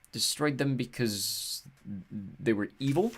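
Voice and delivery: slow, hopeful question; hope for the kinder answer. Acted. Walt asking the goddess hopefully, slow and hopeful. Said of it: "he said hopefully".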